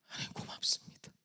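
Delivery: hushed re-enacted prayer of gratitude; barely voiced grateful prayer; whispered thanks. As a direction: whisper thanks to God, overcome. Tearful thanks after God's words.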